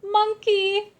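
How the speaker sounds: high, loud yell; anguished loud shout. Acted. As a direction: cry out for the toy. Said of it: "yelled Scipio".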